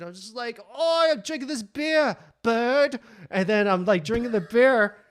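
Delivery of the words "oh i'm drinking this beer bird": loud, high mock-Aussie bluster; fake Australian accent. Faker answering what he's drinking, loud mock Australian. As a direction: overdo the bad Australian accent. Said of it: "I love Australian accents".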